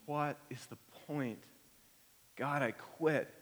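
quiet giving-up murmur; despairing sigh. Acted. Voicing despair of believer.

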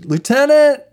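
high, loud cop's announcement; cop calling out. Retold or imitated. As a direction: call it out to the back office like a desk cop. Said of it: "he just goes".

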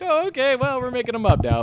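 high-pitched "oh, okay" sliding down; mock acceptance. Host reacting to made-up names, mock acceptance. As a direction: go up high in feigned going-along. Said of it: "I'm like".

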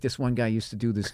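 ordinary talk, nothing marked

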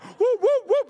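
high, loud mock whoops; imitated angry fuss in high whoops; imitated whooping. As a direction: whoop like someone comically worked up. Imitates the angry woman's reaction.

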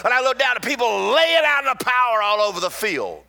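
rising to a full-force preaching shout. Real, not acted. Preacher shouting his testimony to the congregation, building loud. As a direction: shout it out as the power hits.

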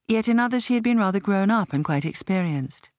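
plain reading voice

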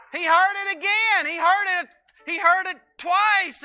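loud, high, driving sermon delivery; preaching at full force. Acted. Pastor to congregation, preaching hard and loud.

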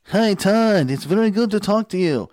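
high, loud imitation of an Italian accent; Italian accent impression. Todd imitating Giovanni's phone greeting, Italian accent. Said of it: "he has this incredible accent".

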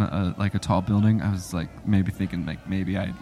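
ordinary talk, nothing marked

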